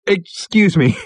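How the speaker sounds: high, loud, gasped greeting; pulling himself together. A narrator's reading. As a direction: get it out while recovering from laughter. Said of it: "Ford pulled himself together".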